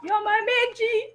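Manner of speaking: high, laughing-crying love; laughing through tears. Real, not acted. Winner laughing through tears, thanking the host. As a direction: gush while laughing and tearful.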